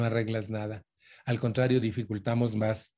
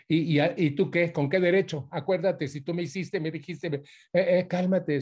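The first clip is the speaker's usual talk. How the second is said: angry outburst; 6 semitones higher than usual. Shown as a demonstration. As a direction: fire back, stumbling over your words in outrage. Acting out losing one's temper.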